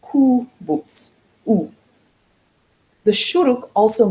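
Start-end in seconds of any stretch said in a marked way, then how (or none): 0.0-1.1 "kubutz" sounded out high, ku... butz; OO vowels. Shown as a demonstration. Teacher reading the example for learners, syllables separated. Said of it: "with the oo underneath". 1.5-1.7 "oo" on its own as a pure vowel; OO sound. Shown as a demonstration. Teacher isolating the vowel for learners, OO sound. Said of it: "It's just an oo sound".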